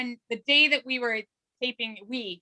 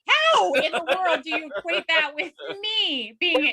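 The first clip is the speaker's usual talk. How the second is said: indignant protest; 4 semitones higher than usual. Real, not acted. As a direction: object in exasperated disbelief. Mock outrage at being blamed.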